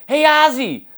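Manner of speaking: very high, loud comic holler; Jerry Lewis. Retold or imitated. Comedian hailing a fan from across the gym, high shout. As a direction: yell it across the room in a squawking voice. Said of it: "I heard from across the gym".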